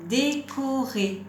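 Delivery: slow, drawn-out dictation of Décorer; measured, syllable-by-syllable teacher's model; word dictation. Shown as a demonstration. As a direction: stretch each syllable of the word.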